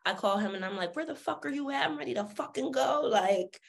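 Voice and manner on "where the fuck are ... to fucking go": sassy. Retold or imitated. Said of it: "I've always been this sassy".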